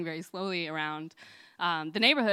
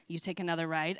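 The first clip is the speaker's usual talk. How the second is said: ordinary delivery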